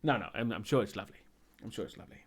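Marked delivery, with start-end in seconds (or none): 1.6-2.2 unvoiced whispered repeat; whispered aside. Real, not acted. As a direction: whisper I'm sure it's lovely much softer. Repeats the reassurance under breath.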